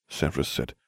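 ordinary delivery

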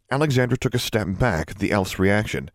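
plain reading voice

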